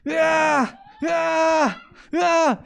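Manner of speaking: high strained wrestling cries; wrestling grunts. Acted. Teacher acting Jacob wrestling the messenger, strained cries. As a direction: heave out each cry as if grappling.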